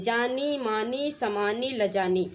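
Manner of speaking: जानी मानी समानी लजानी slowly, each ending नी drawn out; slow word-by-word list landing on the नी of जानी, मानी, समानी, लजानी; rhyme endings shown. Shown as a demonstration. Teacher showing students the rhyme, जानी मानी समानी लजानी slow with नी marked. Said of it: "नी नी मिला ना".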